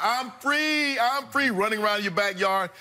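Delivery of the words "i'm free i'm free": high, gleeful chant imitating him; imitating gleeful shouting; mock celebrating. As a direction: crow it like a kid let loose. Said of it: "talking about".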